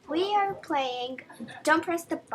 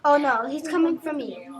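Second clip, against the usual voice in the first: normal talking voice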